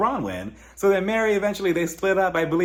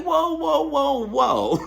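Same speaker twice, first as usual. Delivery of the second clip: mock alarm; 11 semitones higher, much slower than usual. To Heather on the show, a high alarmed whoa whoa. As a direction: throw your hands up, pitch jumps way up.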